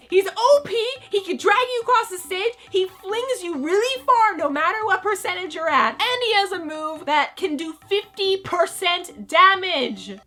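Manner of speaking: high, loud exasperated give-in; Sakurai caving. Retold or imitated. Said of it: "And then Sakurai's like".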